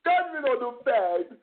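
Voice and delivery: high-pitched zealot calling down judgment; mock hellfire preacher. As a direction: preach damnation in a hammed-up rising cry. Mock fire-and-brimstone preacher.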